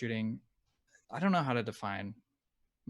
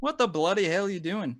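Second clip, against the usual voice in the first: higher mock outburst in put-on Aussie, slipping towards English; attempted Australian accent; 8 semitones higher, 4 dB louder than usual. Shown as a demonstration. Guest copying the host's phrase in an imitated Australian accent. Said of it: "That's kinda like a English".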